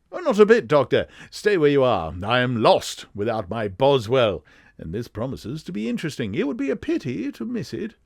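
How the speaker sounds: high-pitched, very English detective; lively English gentleman, leaping up in pitch; Sherlock Holmes. Acted. Detective urging his doctor friend to stay, high-pitched English voice. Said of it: "his high-pitched, very English voice".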